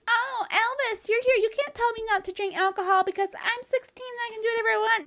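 put-on very high, whiny girly voice; high bratty teen-girl whine; mock teen girl. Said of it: "she's like".

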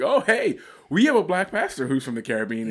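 high, loud, eager greeting; excited dad. Retold or imitated. Dad loudly greeting a Caribbean driver, excited. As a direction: burst out with a loud eager hello. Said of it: "He's like".

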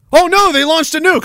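high-pitched mock alarm; hammed-up cry of dismay; mock panic. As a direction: pretend to panic at the news. Mocks victims' panic at nuke.

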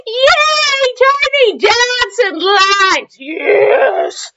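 high, drawn-out mock cheer; over-the-top gleeful celebration; excited. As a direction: squeal with delight, stretching the words. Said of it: "I get excited every time I see punctuation".

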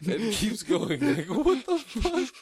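laughing through the words; cracking up while talking. Real, not acted.

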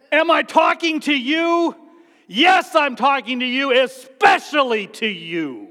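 forceful rebuke, slowing at the end; emphatic shout. Retold or imitated. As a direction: hammer it home, especially to you. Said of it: "My hunch is Jesus did like".

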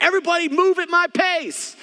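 high-pitched exasperated yell, acted out; frustrated outburst. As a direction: act out a parent at the end of their rope. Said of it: "get so tired and get frustrated".

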